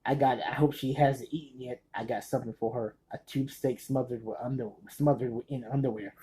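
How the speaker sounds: soft, lightly imitated movie character; Cheech. Fan reciting a character's line to his buddy, softly imitated. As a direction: quote the line low and quiet, in character. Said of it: "He says".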